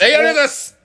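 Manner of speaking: very high, loud celebratory cry; thrilled, high-pitched outburst; jubilant thanks. Real, not acted. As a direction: shout your thanks like you just won. Celebrating a correct quiz answer.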